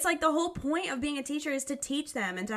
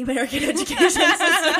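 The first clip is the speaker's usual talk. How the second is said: louder, quick and breaking into laughter; laughing; 6 semitones higher, 10 dB louder, faster than usual. Real, not acted. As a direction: crack up while talking. Joking summary, breaks into laughter.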